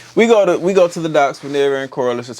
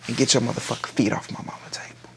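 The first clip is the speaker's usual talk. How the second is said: fast, soft unvoiced whisper of "get your motherfucking feet off my mama table"; hiss-free quick whisper, much softer; 8 dB quieter, faster than usual. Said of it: "the whole gist of that conversation was".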